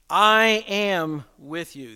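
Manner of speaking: "I" slow and leaned on, falling at the end; slow, weighty "I am with you" with the weight on "I"; stress on I. Shown as a demonstration. Preacher to congregation, stressing "I" slowly. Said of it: "The "I" here is emphatic".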